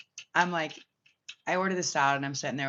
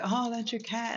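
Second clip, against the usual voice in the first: lightly voiced higher guy; a hint of his surprised tone; imitating the guy; 9 semitones higher than usual. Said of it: "he's like".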